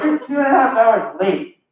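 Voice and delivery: mock angry customer. Angry customer scolds him.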